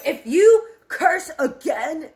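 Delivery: grandpa's angry warning; angry threat. Retold or imitated. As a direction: bark the threat at her.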